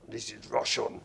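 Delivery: loud Russian accent; bold, jokey attempt at Russian. Shown as a demonstration. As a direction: put on a mock Russian accent. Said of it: "That's my Russian accent".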